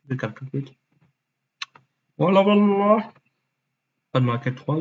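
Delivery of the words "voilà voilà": mock voilà. Put-on voice.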